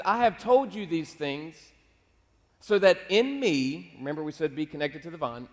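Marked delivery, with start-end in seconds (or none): 0.0-3.9 slow, raised scripture delivery; solemn proclamation. Retold or imitated. Jesus telling his disciples his last things, slow emphatic proclamation. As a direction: proclaim it phrase by phrase, with weight.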